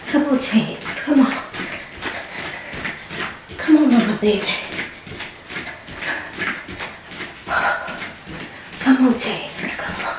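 effortful push; straining. Real, not acted. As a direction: strain through each word. Straining during exercise, calling baby.

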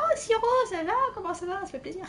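high-pitched mock effusive hello; put-on high voice for a chatty welcome; imitated greeter. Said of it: "Il va me dire".

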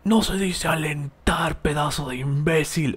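a hint of a nasty forum troll correcting; vile troll. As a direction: sneer it out like a forum troll, slowly. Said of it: "de la forma más vil posible".

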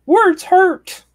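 very high, loud mock-wounded cry; mock hurt. Mocking people offended by jokes, loud high-pitched whine. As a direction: squeal it like an exaggerated crybaby.

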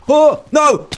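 high, loud sudden yelp; shocked cry. Real, not acted. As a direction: caught off guard mid-fall. Falls out the bottom.

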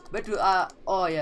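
ordinary talk

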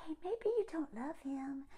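very soft, gentle hush; quiet inner voice. Retold or imitated. Voicing Jessica's intuition to her, very soft gentle murmur. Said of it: "that quiet voice".

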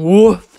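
one big rising woof; dog's woof. A narrator's reading. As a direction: bark it out like a dog. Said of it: "said the dog".

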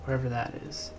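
unhurried meditation guide, long pauses between phrases; guided meditation. Acted. Guide asking listeners to sink into the sensation, guided meditation. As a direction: guide listeners inward in short, spaced phrases.

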